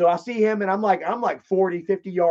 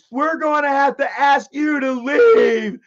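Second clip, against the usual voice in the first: high, loud, slow official voice; hammed-up polite usher ordering him out; mock usher; 8 semitones higher, 5 dB louder, slower than usual. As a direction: usher telling a drunk to leave, mock formal. Usher asks him to leave.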